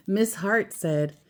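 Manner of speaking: ordinary delivery, nothing marked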